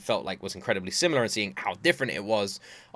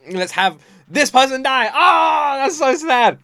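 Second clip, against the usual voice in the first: much higher and louder, delighted cruelty; mock gleeful songwriter; 12 semitones higher, 9 dB louder, slower than usual. [name] plotting a sad lyric, loud high mock delight. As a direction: squeal the tragic idea with glee. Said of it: "And then".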